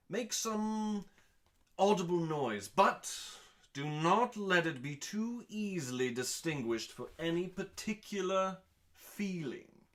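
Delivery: normal talking voice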